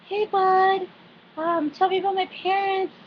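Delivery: high-pitched imitation of a woman asking; mock high voice for a quoted question; acted sweet questioner. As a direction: pitch it way up, held high throughout. Acting out Sookie's question to Bud.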